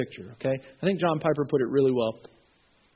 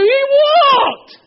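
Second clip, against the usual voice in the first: joyful cheer; 13 dB louder than usual. Retold or imitated. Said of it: "There's like a party".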